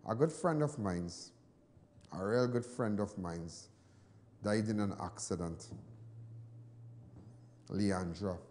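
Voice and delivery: slow, soft and heavy with grief; sad. Real, not acted. Grieving his friend, slow and soft, sad. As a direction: let the loss weigh on each word.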